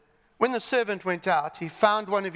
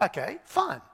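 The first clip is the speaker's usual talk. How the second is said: ordinary talk, nothing marked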